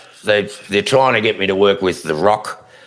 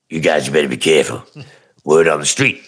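low-pitched street informant tipping off detectives; Huggy Bear; 5 semitones lower than usual. Pretend. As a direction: drop low and warn them like a streetwise tipster. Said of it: "do your Huggy Bear".

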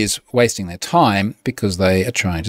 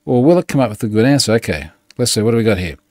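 ordinary talk, nothing marked

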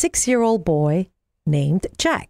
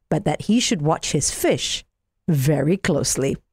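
ordinary delivery, nothing marked

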